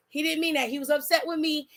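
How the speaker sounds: usual speaking voice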